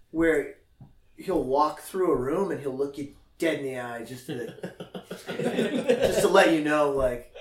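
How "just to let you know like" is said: normal talking voice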